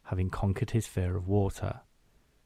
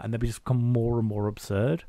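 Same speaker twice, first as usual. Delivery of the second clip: usual speaking voice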